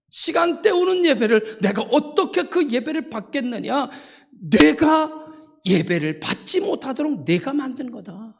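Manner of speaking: high, impassioned preacher voicing God's rebuke; God rebuking. God telling Cain his rejected worship is his own doing, impassioned rebuke. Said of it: "하나님께서 이렇게 말씀하시는 것입니다".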